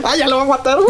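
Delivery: high-pitched, laughing mock anticipation; retold excitement. Excited moviegoer awaiting the shot, laughing mock excitement. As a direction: squeal it as if the shot is coming. Recalling his excitement in the cinema.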